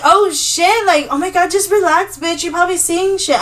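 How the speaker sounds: high, loud mock exasperation; retold dismissive reassurance. Telling a scared friend to relax, high and exasperated.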